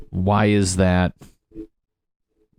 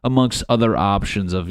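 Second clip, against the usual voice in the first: normal talking voice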